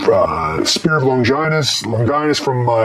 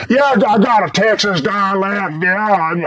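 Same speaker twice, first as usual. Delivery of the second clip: exaggerated Texas drawl, pitched up; drawn-out put-on Texas accent; mock Texas dialect; 5 semitones higher, slower than usual. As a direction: ham up a slow Texas twang. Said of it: "Why don't you have a Texas dialect?".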